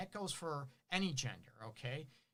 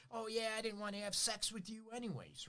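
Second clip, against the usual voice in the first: higher, mocking snipe; sarcastic jab; 6 semitones higher than usual. Rejected partner snipes back, higher mocking sarcasm. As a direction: imitate a spiteful comeback. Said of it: "start criticizing their partner".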